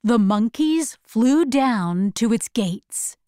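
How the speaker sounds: usual speaking voice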